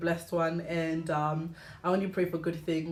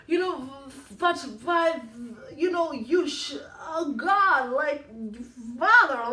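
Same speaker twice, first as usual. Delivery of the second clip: mock formal prayer voice; 6 semitones higher, 4 dB louder than usual. Vlogger mocking her old Christianese prayers, high laughing voice. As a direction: mimic a stiff churchy prayer, high and loud, laughing through it. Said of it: "I used to be like".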